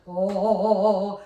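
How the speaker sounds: a low, full "oh" from the chest; chest resonance. Shown as a demonstration. Voice teacher demos chest resonance to students: resonant chest "oh". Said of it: "So you have your chest down here".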